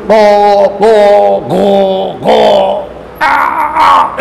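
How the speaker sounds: slow drawn-out "গ" and "আ" sounds; drilled letter sounds for a class; drilling letter sounds. Shown as a demonstration. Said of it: "আমরা বলি".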